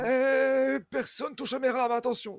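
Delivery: high, loud warning; guard your oars, shouting; possessive shout. Acted. GM voices boatman defending oars.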